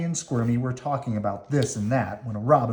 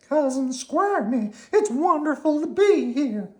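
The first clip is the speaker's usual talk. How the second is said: Slimy delighted; 3 dB louder than usual. Acted. Slimy greets Cousin Squirmy, in a very high delighted voice. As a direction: gush over the visit.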